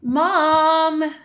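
long drawn-out, plaintive call; whiny calling. Acted. Inessa running to her mom to complain, whining. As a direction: stretch it out, begging for sympathy.

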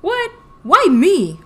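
high, loud shocked protest; loud, indignant disbelief; exclaiming. A narrator's reading. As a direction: blurt it out, stunned at being accused. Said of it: "Brown exclaimed".

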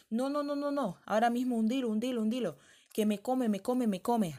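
high, loud, frantic pleading; panicked old woman. Acted. Old woman begs girl to sink the river, frantic high shouting. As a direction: beg for your life as the beasts close in.

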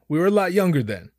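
ordinary talk, nothing marked

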